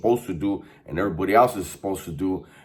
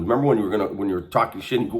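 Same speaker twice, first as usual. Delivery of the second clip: normal talking voice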